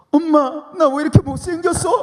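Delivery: mock-wail like a weepy teen sister; crying teenage sister. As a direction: sister imitated with a high tearful whine. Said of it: "눈물을 막 흘리면서".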